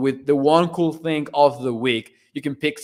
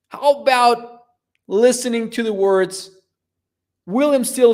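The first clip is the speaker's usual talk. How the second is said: excited; 8 semitones higher, 4 dB louder than usual. Real, not acted. Excited intro of favourite clip.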